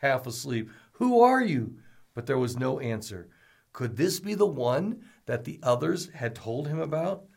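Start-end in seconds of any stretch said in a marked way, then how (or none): 0.9-1.8 asked aloud. A narrator's reading. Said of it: "Novi asked aloud, half asleep".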